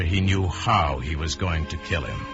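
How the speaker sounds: usual speaking voice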